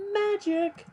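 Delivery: high bright exclamation; excited. Acted. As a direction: announce it like a magic trick. Announcing restored energy.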